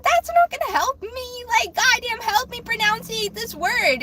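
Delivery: high-pitched, pleading mock outburst; exasperated retold protest. Replays protest at being yelled at.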